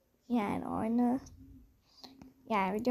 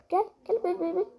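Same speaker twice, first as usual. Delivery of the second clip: taunting call; 6 semitones higher, faster than usual. Real, not acted. Taunting an enemy to approach.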